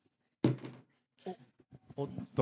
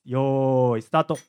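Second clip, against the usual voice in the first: start call; 10 dB louder, slower than usual. Real, not acted. 開始の合図を出す. (大きく伸ばしたスタートの掛け声)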